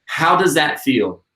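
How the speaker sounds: usual speaking voice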